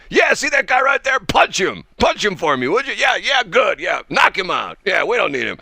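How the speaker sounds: loud, high, egging on the crowd; Trump impression. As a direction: hype the crowd like a brash politician. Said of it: "What other politician would say".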